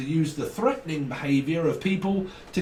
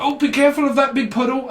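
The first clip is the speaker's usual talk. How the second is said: high, loud over-careful warning; busybody warning an innocent person, high and loud; mock fussy warner; 11 semitones higher, 8 dB louder than usual. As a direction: fuss over them like a busybody. Nagging warner voiced.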